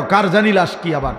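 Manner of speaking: usual speaking voice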